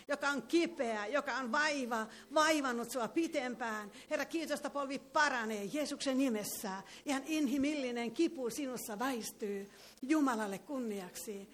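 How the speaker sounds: normal talking voice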